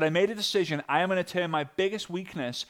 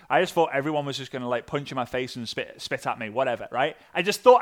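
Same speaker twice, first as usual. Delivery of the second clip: ordinary talk, nothing marked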